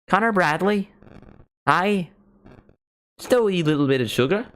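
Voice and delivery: high, swooping Northern Irish lilt; exaggerated Northern Irish sing-song; put-on Northern Irish accent.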